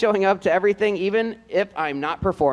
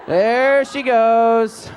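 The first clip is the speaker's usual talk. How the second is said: There she goes, stretched out long and a bit louder; slow teasing drawl; 4 semitones higher, 5 dB louder, much slower than usual. Pretend. Director teasing a student over her wrong-side drill clip, slow and drawn out. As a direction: drag it out to tease her.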